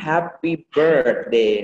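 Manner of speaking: "happy birthday" slow, with r-coloured BIRTH; correct happy birthday. Shown as a demonstration. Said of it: "เราพูดว่า".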